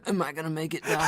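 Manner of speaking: high, laughing wounded patient voice; scared car voice. Retold or imitated. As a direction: plead like a dying patient. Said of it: "the car's, like, asking".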